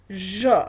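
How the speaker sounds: "je" said clearly in French for repeating; model pronunciation. Shown as a demonstration. Teacher has class repeat the word for I, model pronunciation. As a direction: model French "je", one clean syllable. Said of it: "pronounce after me".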